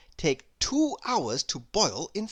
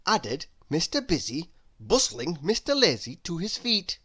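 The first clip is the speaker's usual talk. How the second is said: ordinary delivery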